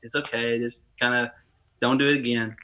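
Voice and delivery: soft, calm mom soothing; softly reassuring mom calming her crying son; gentle reassurance. Retold or imitated. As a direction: hush him kindly like a mother. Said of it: "She said".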